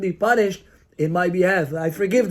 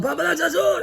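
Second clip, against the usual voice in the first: blunt correction; 9 semitones higher than usual. Retold or imitated. Son bluntly correcting his father.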